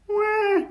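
very high, drawn-out wail; squeaky animal wah; baby seal cry. A narrator's reading. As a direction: bleat like a lost baby seal. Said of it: "cried back".

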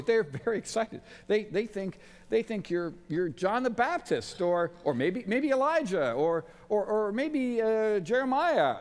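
bursting to tell Jesus the rumours; excited disciples. Retold or imitated. Disciples retold, eagerly answering Jesus.

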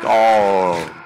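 very low, loud groan; deep death groan. Acted. Character dies in game, deep loud groan. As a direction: drop low and loud on dying.

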